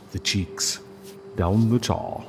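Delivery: ordinary delivery, nothing marked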